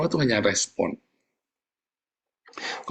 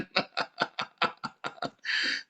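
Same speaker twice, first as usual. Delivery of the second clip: breathless giggling laugh; soft unvoiced laughter; real laugh; 9 dB quieter than usual. Real, not acted. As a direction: laugh quietly at your own joke. Laughs at own joke.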